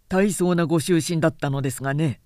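ordinary delivery

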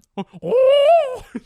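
long, very high falsetto hoot; piercing silly bird call; cartoon hen noise. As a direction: let out a loud, drawn-out mascot whoop. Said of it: "that's his, the noise".

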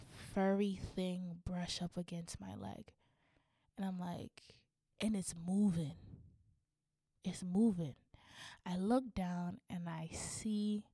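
soft, suspenseful storytelling; quietly creeping toward the reveal; hushed suspense. Retold or imitated.

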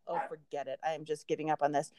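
low, soft resigned aside. Retold or imitated. Telling her family how she quit frosting, resigned. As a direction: drop into a quiet, low give-up. Quoting herself quitting the cookies.

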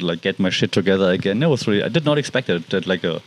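ordinary delivery

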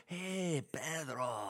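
put-on mixed Italian and Mexican accent; Italian-Mexican accent blend. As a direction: blend Italian with a Spanish lilt. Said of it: "if I blend the two".

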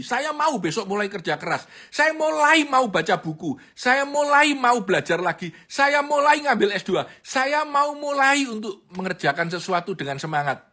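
high, loud eager vows; mock eager promising. Speaker parodies people making eager, high, loud promises. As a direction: parody grand resolutions.

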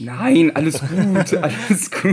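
real laughter through the words; laughing reassurance. Real, not acted. Laughingly reassuring the embarrassed guest.

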